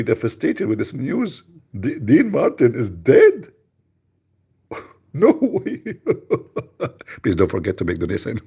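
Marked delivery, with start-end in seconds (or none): 1.8-3.5 mock shock. 4.7-7.2 high, laughing no way; giggling at the news; laughing disbelief. Real, not acted.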